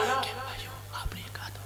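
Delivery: voiceless, barely audible whisper; lost voice. Acted. Poet addressing the audience at the mic with a frozen throat, voiceless whisper. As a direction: imitate a frozen throat with only breath coming out. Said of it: "मेरी आवाज बैठ गई, गला जम गया".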